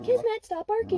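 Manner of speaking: high, pleading dog command. Shown as a demonstration. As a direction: beg the dog to stop, sing-song. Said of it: "See the difference?".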